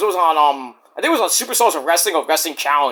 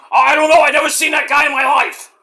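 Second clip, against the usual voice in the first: Satan; 6 semitones higher, 3 dB louder than usual. Acted. Satan answers from below.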